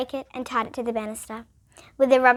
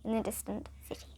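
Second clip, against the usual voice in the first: hushed, fading whisper; 3 semitones lower, 15 dB quieter, faster than usual. Acted. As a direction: let it drift off in a whisper. Trailing whisper in narration.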